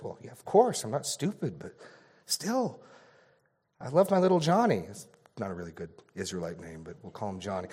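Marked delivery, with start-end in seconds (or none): 0.0-4.9 higher, fretful father protesting; a worried dad pleading his fear, voice up high; nervous father. Acted. Father worried for his little Johnny, high and nervous. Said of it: "The first says".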